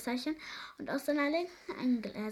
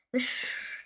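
whooshing whoosh; 8 dB louder than usual. A narrator's reading. Imitated rush of flying past.

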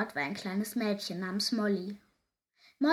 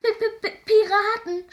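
very high, loud panicked shout; stutter, then yell the alarm; terrified lookout crying out; stammering scream; 12 semitones higher, 9 dB louder than usual. A narrator's reading. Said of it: "schrie plötzlich der blaue Hein".

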